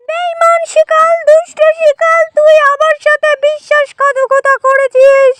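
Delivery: high-pitched bunny crying for help, acted; accuse, then plead in a high rabbit voice; betrayed rabbit pleading.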